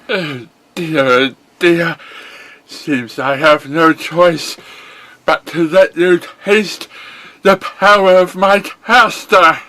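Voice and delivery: bright, high cartoon pony hero. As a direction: play the chipper young pony hero.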